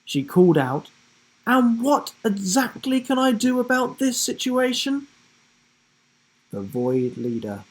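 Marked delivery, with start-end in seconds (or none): 1.4-5.1 put-on higher, sceptical girl voice; a clever girl questioning the plan; girl character. A narrator's reading. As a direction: call it out with a doubtful edge.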